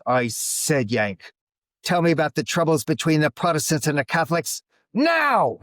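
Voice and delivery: mock-Irish demand building to a shout; angry Irish drunk. Angry drunk demands an answer before slamming table, Irish accent rising to shout. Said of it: "He was an angry drunk".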